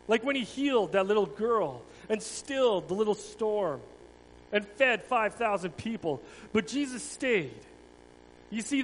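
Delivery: a dramatic storyteller's read; children's story reading. Acted. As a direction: tell it to the children with feeling. Tells the children's Passion story.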